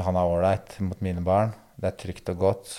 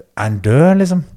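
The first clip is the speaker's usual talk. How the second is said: panicked cry, much louder; imitating the ex-wife screaming in fear; retold scream; 8 semitones higher, 11 dB louder than usual. Ex-wife fearing the climber father is dead, retold panicked scream. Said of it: "det skriker da ut".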